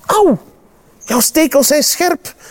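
high-pitched cry of protest; pained yelp. A narrator's reading. Panda to the prickly animal after touching it, pained high yelp. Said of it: "gilt hij".

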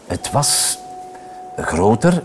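plain reading voice

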